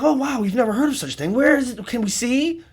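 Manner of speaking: high, quick wide-eyed wonder; mock amazement. Partygoers begging to see a demon, mock amazed and high. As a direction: imitate an awed, eager crowd.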